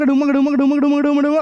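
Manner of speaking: rising, playful dhol beats; wedding drum imitation. Shown as a demonstration. Teacher teasing student about his dhol, playful drumming. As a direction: beat the dhol with your voice, building up. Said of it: "तो यहाँ पे डुमक".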